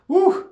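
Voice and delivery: high, loud whoop of disbelief; exclaimed "woo". Real, not acted. Vlogger reacting to friends going days without water, high whoop. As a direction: let out a sharp, high "woo!".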